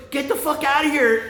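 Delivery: high-pitched retold warning; mock urgent yell. Ambulance guy warning bikers to leave, high urgent mock yell. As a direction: yell it high, imitating an alarmed stranger.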